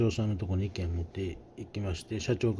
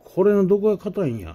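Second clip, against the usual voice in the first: much higher and louder, baffled; indignant disbelief; 11 semitones higher, 9 dB louder than usual. Retold or imitated. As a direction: exclaim in outraged disbelief. Baffled boss after tasting.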